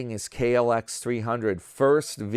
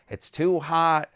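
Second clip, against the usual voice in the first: put-on high, louder complaining girlfriend; mocking his girlfriend's dislike of Miami, higher and louder; imitating Leslie; 7 semitones higher, 4 dB louder than usual. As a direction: whine about the heat, pitched up. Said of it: "She's like".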